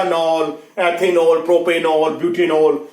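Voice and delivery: rounded long 'o' in नॉल at each word's end; alcohol suffix pronunciation. Shown as a demonstration. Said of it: "उसके लिए बोला जाएगा". (एथेनॉल जैसे शब्दों में 'नॉल' का गोल उच्चारण)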